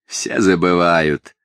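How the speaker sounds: loud sardonic jab, slowing at the end; biting, emphatic retort; caustic. A narrator's reading. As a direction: needle with knowing scepticism. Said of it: "язвительно заметил мальчик".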